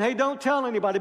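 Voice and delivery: a wink-wink warning meant to be broken; sly reverse psychology. Retold or imitated. Jesus as some imagine him, slyly urging the leper to tell.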